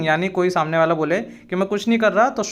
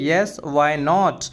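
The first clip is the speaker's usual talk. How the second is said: slow, carefully spelled-out English phrase; slow and clear classroom model phrase; slow teaching demo; 3 semitones lower, much slower than usual. Shown as a demonstration. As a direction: say each word slowly for the learner. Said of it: "simply short sentence में हम बोल देंगे".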